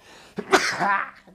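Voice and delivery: sharp spluttering cough; real high cough. Real, not acted. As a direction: cough through a stuffed nose. Sneeze attempt, nose stuffed.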